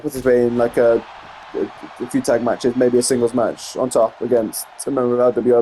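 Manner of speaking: normal talking voice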